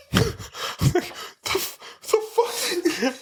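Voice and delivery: breathless, high laughing whisper of disbelief. Real, not acted. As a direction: wheeze it out through laughter. Disbelief at coworker's remark.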